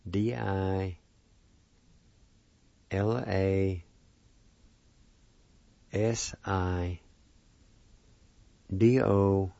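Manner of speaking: letters in pairs with long pauses; spelled out slowly. Shown as a demonstration. Teacher spelling a word for learners, slowly letter by letter. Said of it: "It's spelled this".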